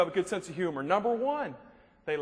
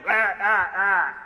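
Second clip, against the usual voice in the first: loud, barked "rah" noises; mock griping noise; 7 dB louder, much slower than usual. Tour guide's complaining lady, loud mock griping. Mimics a lady's endless complaining.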